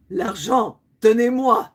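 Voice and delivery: loud, demanding robber voice; mock holdup demand. Pretend panhandler demanding money like a robber, loud and bossy. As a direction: bark it like a stickup. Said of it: "makes it sound like I'm gonna hold them up".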